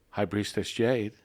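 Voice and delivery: normal talking voice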